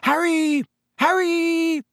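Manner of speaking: high, drawn-out call across the street; yelled name. A narrator's reading. Friends spot Harry and call him over, yelling high. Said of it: "someone yelled his name".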